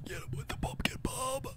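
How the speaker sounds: unvoiced whisper of "Get him with the pumpkin bomb". Whispered repeat of the order.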